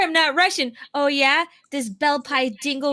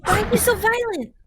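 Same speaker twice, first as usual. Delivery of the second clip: mock alarmed protest; 7 semitones higher, much slower than usual.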